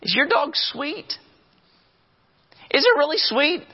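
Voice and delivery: a preacher teasing the congregation about the sweet dog; incredulous mock disbelief. Real, not acted. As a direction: needle the owner with high incredulous questions. Mocking the dog owner.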